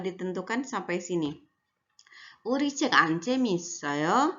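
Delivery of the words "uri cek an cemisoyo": slow, higher Korean question, "cemisoyo" swept upward; rising question ending. Shown as a demonstration. Teacher modelling a polite Korean question, rising ending. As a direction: lift the last syllable of "cemisoyo" clearly. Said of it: "akhirannya intonasinya juga menaik".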